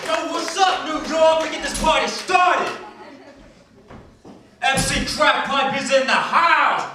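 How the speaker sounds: high shouting hip-hop MC voice, pumping up the crowd; hype MC. Acted. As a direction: hype the room like a party rapper. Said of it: "got a little carried away there".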